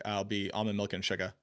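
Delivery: Bostonian, dropping the final r in "sugar"; Boston accent. Retold or imitated. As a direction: order casually in a Boston accent. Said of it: "as pronounced as the accent that I have".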